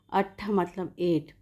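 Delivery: normal talking voice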